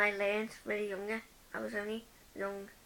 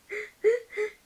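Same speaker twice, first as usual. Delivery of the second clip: laugh; 12 semitones higher, 10 dB louder than usual. Real, not acted. Laugh after crying imitation.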